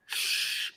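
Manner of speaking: soft, breathy shushing sound. Retold or imitated. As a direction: hush someone gently with a long "shh". Acts out his friend shushing him.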